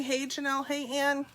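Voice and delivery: ordinary delivery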